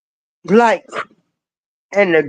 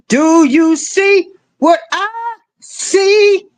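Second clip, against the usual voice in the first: high, slow, shouted challenge; emphatic shout; 8 semitones higher, slower than usual. Real, not acted. Ranting at the audience about the agenda, slow rising shout. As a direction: drag each word out like a preacher's call.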